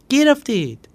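high, loud sudden outburst; panicked blurt. Acted. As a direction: blurt it before thinking. Chaghak blurting out. (پسربچه با فریاد ناگهانی)

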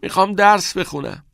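loud, high, slightly strained; mildly hoarse, high-pitched brush-off; hoarse, choked. A narrator's reading. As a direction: push the friend away, voice choked. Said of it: "صدایش خشدار و گرفته بود".

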